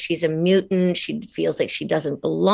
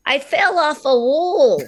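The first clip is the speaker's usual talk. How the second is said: "wall" said the Australian way, like "wool", higher and slow; 8 semitones higher, 4 dB louder than usual. Retold or imitated. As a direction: say "wall" rounded like "wool", as the new kid. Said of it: "And I would say".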